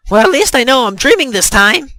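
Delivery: high, loud call into the void; loud warning. A narrator's reading. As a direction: call it out for anyone listening. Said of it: "said out loud as to warn".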